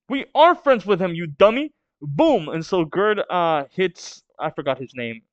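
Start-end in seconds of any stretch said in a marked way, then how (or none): 0.1-1.7 high, loud indignant yell; defiant kid shout. Acted. A kid defending his friend Loki, indignant yell. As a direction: shout it back at the bully, high-pitched.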